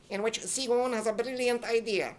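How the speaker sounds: ordinary talk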